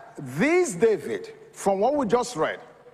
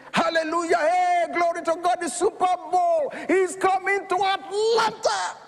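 jubilant shout; 9 semitones higher than usual. Retold or imitated. Falcons fan celebrating a big lead, jubilant shouting. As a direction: whoop like your team just clinched it. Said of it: "we were yelling, shouting, jubilating".

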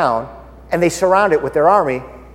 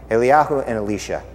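normal talking voice

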